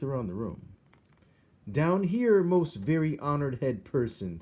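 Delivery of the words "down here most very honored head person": small mouse shouting up from the floor; high mouse voice calling up. A narrator's reading. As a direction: call up loud and high like a tiny mouse. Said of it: "said Despero".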